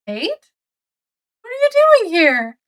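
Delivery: high-pitched acted surprise; imitating a startled acquaintance; surprised. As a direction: question it in disbelief, voice shooting up. He finds her at the scene.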